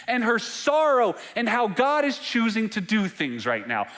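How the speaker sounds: high, loud, impassioned preaching; preaching at full force. Acted. Preacher urging the congregation about Martha's faith, fervent preaching. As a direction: preach it out with fire, pitch up.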